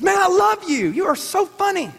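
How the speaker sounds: lightly voiced excited fan at a party; imitating a fan. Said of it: "He goes".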